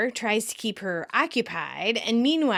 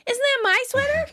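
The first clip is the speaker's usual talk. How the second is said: very high, louder mock indignation; indignant question; 4 dB louder than usual. Claiming the lamented blue sweater, indignant. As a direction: challenge her in a squeaky, affronted voice.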